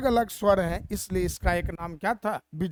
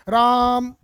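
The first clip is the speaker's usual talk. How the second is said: राम called out loud and drawn long; calling from far; 3 semitones higher, 8 dB louder, much slower than usual. Shown as a demonstration. Teacher calling राम to a distant person to show the prolonged vowel. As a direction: call राम to someone far away, stretching the vowel. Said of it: "दूर से संबोधित करते हैं".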